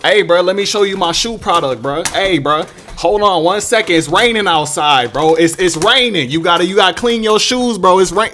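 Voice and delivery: loud, pushy hustler pitch; imitating a mall shoe salesman chasing him loudly. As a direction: call out to a passing shopper, loud. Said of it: "shoe dude like".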